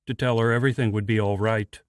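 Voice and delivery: plain reading voice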